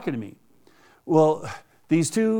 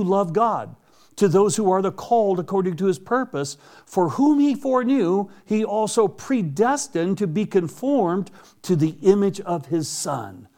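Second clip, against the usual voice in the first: scripture reading; 3 semitones higher than usual. Acted. Reads Romans aloud.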